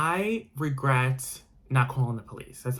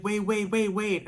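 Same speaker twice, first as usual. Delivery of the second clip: high urgent calls of wait; calling after her; 9 semitones higher, slower than usual. Retold or imitated. The date chasing her down the stairs, calling wait. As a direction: yell after someone running off, pitched up.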